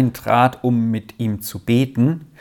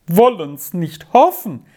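higher, emphatic echo of dismay; despairing; 4 semitones higher, 4 dB louder than usual. A narrator's reading. Aunt repeats the doctor's answer, despairingly. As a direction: throw the words back in despair. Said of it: "mit verzweiflungsvollem Ton".

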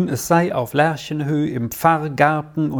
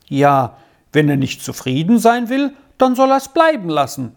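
huffy, climbing high toward the end; indignant; 4 semitones higher, 3 dB louder than usual. A narrator's reading. Squirrel takes Arthur's side against the professor, indignant. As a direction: dismiss him with rising childish indignation. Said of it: "erklärte Squirrel entrüstet".

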